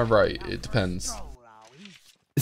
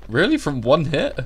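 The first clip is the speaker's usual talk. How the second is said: surprised; 5 semitones higher, 4 dB louder than usual. Real, not acted. Surprised at one hit.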